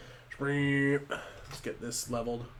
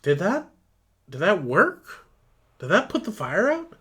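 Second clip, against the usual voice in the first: higher, amazed questions rising up; in awe; 7 semitones higher, 5 dB louder than usual. Acted. Driver Steve amazed the fire went out, rising awe. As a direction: gasp it out in disbelief. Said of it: "the driver in awe".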